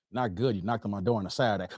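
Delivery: low, soft, quick grumble; grumpy homeowner. Acted. Dave to a Saturday morning caller, low grumpy grumble. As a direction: complain low and under your breath. Dave annoyed at doorstep visitor.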